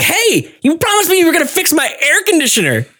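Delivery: put-on high, fast girlish demand. Girl grabbing the boy's arm, high and fast. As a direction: nag him about the promise. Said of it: "was like".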